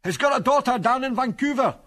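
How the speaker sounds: high-pitched sustained calling; shout. A narrator's reading. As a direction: shout the request across a distance. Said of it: "shouted Angus".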